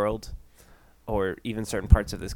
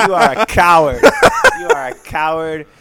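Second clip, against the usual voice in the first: raised, loud hammed-up scolding; mock denunciation; 5 semitones higher, 8 dB louder than usual. Host scolding Levi as a coward, mock-angry. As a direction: denounce him with comic outrage, easing off on the repeat.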